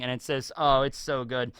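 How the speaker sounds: ordinary talk, nothing marked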